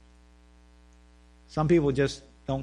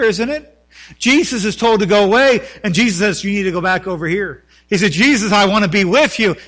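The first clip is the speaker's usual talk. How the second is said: loud, rising sermon delivery; fervent preaching; 5 semitones higher, 6 dB louder than usual. Acted. Preacher pleading with his church, loud and impassioned. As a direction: pastor urging the congregation, preaching loud and fervent.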